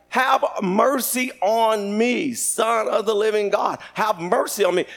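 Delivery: slow, stretched acted supplication; drawn-out plea. Blind man praying to Jesus, slow drawn-out pleading. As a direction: draw out each phrase as a plea. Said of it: "He's like".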